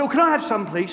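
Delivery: high, loud, flustered 'can I have some'; panicked polite request. Retold or imitated. Preacher dodging a face massage, flustered high and loud. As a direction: grab the lotion before she reaches your face. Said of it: "So I said".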